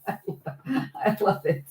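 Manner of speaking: breathy laugh through the words. Real, not acted. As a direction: laugh it out, can't help it. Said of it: "Why am I laughing?".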